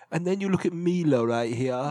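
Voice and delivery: lightly put-on British accent. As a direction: imitate the British presenter. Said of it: "he's like".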